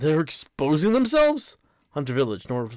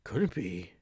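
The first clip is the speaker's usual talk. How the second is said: ordinary delivery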